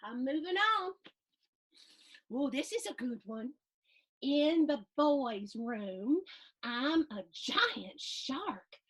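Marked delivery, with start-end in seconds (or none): none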